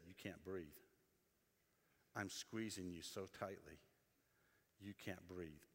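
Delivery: playful grandpa pretending to squeeze; teasing dad.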